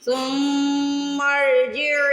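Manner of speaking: ارجع with a clear throaty ع; clear ain demo. Shown as a demonstration. Teacher modelling the ain sound for students, slow recitation. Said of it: "عین کی آواز نکال لیں گے".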